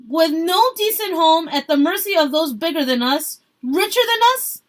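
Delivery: high, loud protest speech; rallying shout. A narrator's reading. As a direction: fire up the workers. Marta rallying the crowd.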